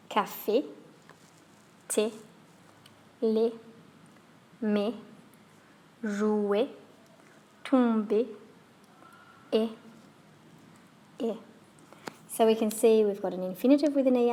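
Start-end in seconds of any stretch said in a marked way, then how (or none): 0.1-11.4 «Café», «thé», «lait» each end in a short, sharp French é; French é words. Shown as a demonstration. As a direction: clip every é short and sharp. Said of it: "Let's have a look at some words".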